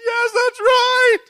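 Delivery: high, loud, drawn-out cry imitating her; retold tearful outburst. Crying woman confirms the dream's meaning, retold tearful shout. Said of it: "She starts crying".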